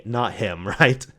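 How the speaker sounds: usual speaking voice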